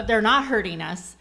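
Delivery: ordinary talk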